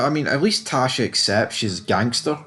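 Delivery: ordinary talk, nothing marked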